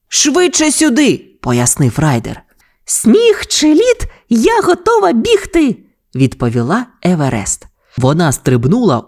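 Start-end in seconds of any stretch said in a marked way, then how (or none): 0.1-1.2 high, drawn-out summons; urgent call. A narrator's reading. Ryder calls husky to blocked railway, high urgent call. Ryder urges Everest to hurry. 2.8-5.8 very high, drawn-out husky pup voice; Еверест. A narrator's reading. Husky pup answers the rescue call, high eager voice. Everest's catchphrase reply to Ryder.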